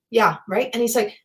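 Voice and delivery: normal talking voice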